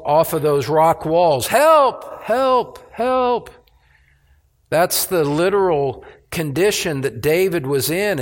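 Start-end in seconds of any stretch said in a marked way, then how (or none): 1.5-3.5 desperate cry for help. Retold or imitated. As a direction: call out like a man alone in a dark cave. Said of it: "as he was crying out with his voice".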